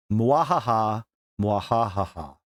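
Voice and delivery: hammy evil villain cackle; sneering panto villain. Pretend. Mock evil laugh after power boast.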